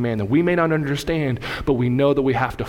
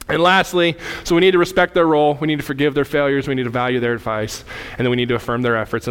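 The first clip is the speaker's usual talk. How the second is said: forceful preaching; 3 semitones higher, 4 dB louder than usual. Acted.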